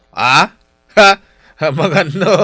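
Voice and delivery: loud, goading repeated questions; taunting. Acted. Husband goading his wife about the Bible, taunting loud questions. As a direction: push her for an answer, jeering.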